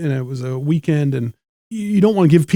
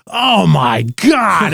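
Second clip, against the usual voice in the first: drawn-out, raised-pitch mock groan; loud, upset heckler; 4 semitones higher, 4 dB louder, much slower than usual. Drunk audience member at a slow play, loud exasperated outburst. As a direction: imitate a drunk complaining loudly and very slowly. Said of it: "he was loud and upset".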